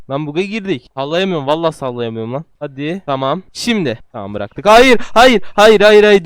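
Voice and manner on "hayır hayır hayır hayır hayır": loud frustrated yell; shouting no. Real, not acted. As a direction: yell no at the screen. Game character failing, shouting.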